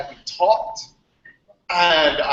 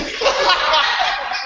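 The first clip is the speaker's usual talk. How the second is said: higher, short laugh; real laugh; 10 semitones higher than usual. Real, not acted. Laughing while telling the hangout about Newberry. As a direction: laugh at your own outrageous story.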